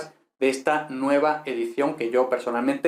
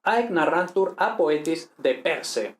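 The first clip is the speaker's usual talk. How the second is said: reading Latin aloud; 3 semitones higher, slower than usual. Shown as a demonstration.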